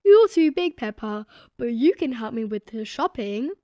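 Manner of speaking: lightly voiced jolly father; gentle dad letting her down; Daddy Pig. A narrator's reading. Daddy Pig telling Peppa she's too big, jolly. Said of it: "laughed Daddy Pig".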